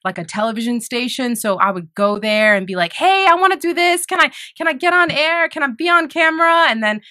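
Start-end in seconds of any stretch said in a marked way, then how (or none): 2.9-6.7 much higher-pitched, keen student voice; eager pleading. Retold or imitated. Student asking high school TV station for airtime, eager. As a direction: act out an eager kid asking for a chance.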